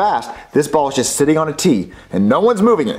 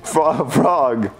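usual speaking voice

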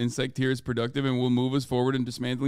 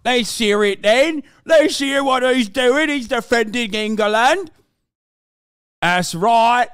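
put-on high, loud, slow British lad accent; mock British accent; 7 semitones higher, 6 dB louder, slower than usual. Streamer mocking a British nationalist, in a British accent. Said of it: "Why are you so good with that accent?".

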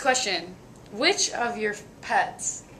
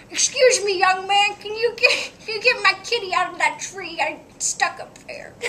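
put-on high, shaky old lady voice; grandma voice; 11 semitones higher, 4 dB louder than usual. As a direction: play a sweet old grandma. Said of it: "Sing a song in your grandma voice".

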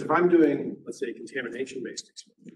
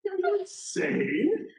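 much higher, very slow mock disbelief; incredulous client; much slower than usual. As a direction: act shocked that anyone would suggest it. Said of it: "They're gonna be like".

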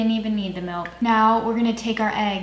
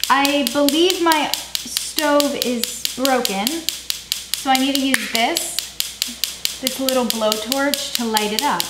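put-on silly voice; 4 semitones higher, slower than usual. Put-on voice about stove.

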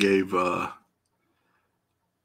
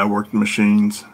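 louder retake; 8 dB louder than usual. Shown as a demonstration. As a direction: say it again, louder and clearer into the mic. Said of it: "That'll probably sound better".

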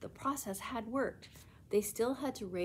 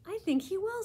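high, bright happy reply; delighted; 14 semitones higher, 6 dB louder than usual. Acted. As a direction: answer with glowing joy. Happy girl agrees.

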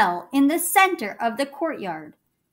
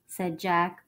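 usual speaking voice

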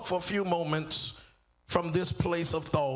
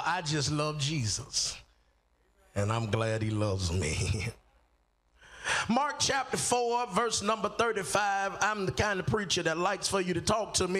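ordinary talk

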